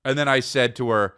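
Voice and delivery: ordinary talk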